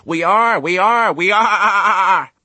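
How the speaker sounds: loud, very high bleating chant; juddering sheep chorus. A narrator's reading. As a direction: bleat it over and over, drawn out. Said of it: "chorused the flock".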